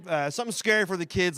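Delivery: ordinary talk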